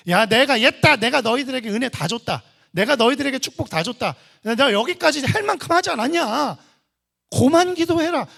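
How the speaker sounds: loud, high exasperated refusal; hammed-up brusque dismissal; mock irritated God. Mock God refusing further prayers.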